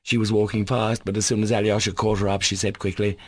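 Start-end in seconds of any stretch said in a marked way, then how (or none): none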